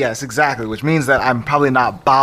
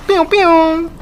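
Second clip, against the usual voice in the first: very high "pew pew" zaps; blaster sound; 14 semitones higher, 4 dB louder, slower than usual. Pretend. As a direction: fire pretend ice blasts with your mouth. Pretending to shoot ice powers.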